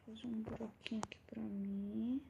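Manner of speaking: soft whisper. Real, not acted. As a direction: whisper it to yourself. Settling down to sleep.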